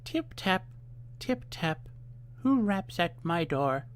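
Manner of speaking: high sweet voice calling from inside. A narrator's reading. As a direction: coax them in with a sugary high voice. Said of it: "a sweet voice called out".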